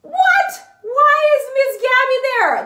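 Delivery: high squeal of fake shock; mock surprise. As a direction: act startled to see yourself. Spots herself on screen, mock shock.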